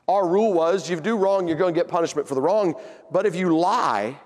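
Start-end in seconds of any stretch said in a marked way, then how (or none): none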